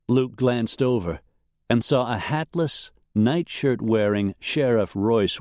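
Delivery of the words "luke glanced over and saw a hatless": ordinary delivery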